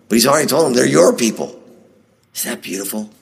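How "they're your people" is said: "your" lifted high in pitch; stress on YOUR. Shown as a demonstration. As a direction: land on "your" to mark the contrast. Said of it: "Notice the connection".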